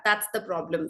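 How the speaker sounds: usual speaking voice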